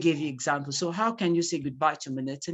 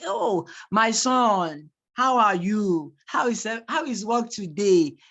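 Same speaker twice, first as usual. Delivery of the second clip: market woman; 4 semitones higher, 4 dB louder than usual. Shown as a demonstration. Teacher playing a market woman greeting a customer, market woman. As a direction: act out a market woman fussing over her son. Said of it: "act like that market woman".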